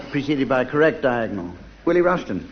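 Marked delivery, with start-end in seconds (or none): none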